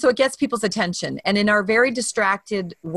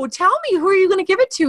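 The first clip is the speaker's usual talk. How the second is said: excited; 12 semitones higher, 3 dB louder, faster than usual. Retold or imitated. Thrilled reply to tow driver.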